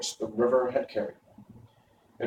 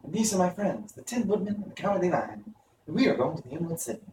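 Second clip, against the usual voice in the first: put-on girl's voice, pitched much higher; a young girl, higher and earnest; Dorothy voice; 6 semitones higher, 4 dB quieter, faster than usual. A narrator's reading. Dorothy to friends on the riverbank, higher and earnest. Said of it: "answered the girl".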